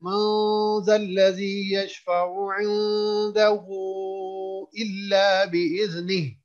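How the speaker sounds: slow melodic chant with long vowels; Quran recitation. Shown as a demonstration. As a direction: stretch each vowel in measured recitation. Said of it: "इस आयत को पढ़ते हैं".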